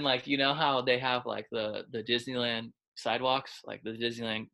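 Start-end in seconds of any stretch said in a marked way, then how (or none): none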